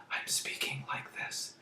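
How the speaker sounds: soft breathy whisper of the sentence. Shown as a demonstration. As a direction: breathe the words out without voice. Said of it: "This is called quietly".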